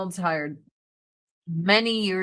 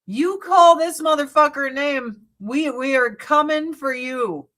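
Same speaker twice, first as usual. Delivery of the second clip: protective warning; 5 semitones higher, 4 dB louder than usual. Retold or imitated. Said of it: "That's why I'm like".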